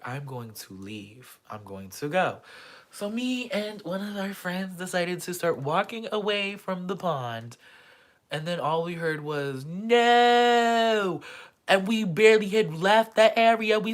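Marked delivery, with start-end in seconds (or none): 0.0-2.4 low, soft muttered leaving; quiet exit. Retold or imitated. Telling herself she will leave, low and quiet. 9.6-11.2 high, loud drawn-out no; shouted no. Retold or imitated. Drunk guy shouting as she walks away, loud yell.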